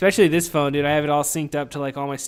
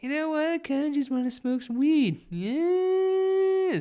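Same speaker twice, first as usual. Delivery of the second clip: much higher, a goofy put-on voice for his own thoughts; high silly voice; 14 semitones higher, slower than usual. Host mimicking his own weed craving in a goofy high voice. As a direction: squeak it out like a cartoon version of yourself.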